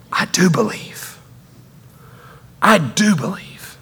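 breathy near-whisper; hushed confession. Acted. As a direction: confess it under your breath, haltingly. Father's anguished confession, acted out.

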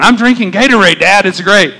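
mock child bragging to dad; imitating his son. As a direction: chirp it like an excited boy. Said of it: "And Caleb's going".

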